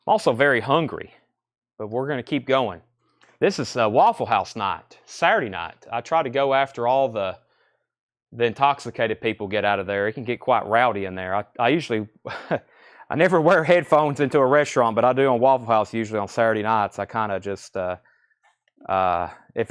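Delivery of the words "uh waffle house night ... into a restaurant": usual speaking voice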